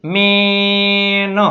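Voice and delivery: મેન with the vowel stretched very long before ન; blending sounds. Shown as a demonstration. Teacher blending the letters for pupils, મેન drawn right out. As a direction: hold the middle of મેન, then release ન. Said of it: "પછી એ".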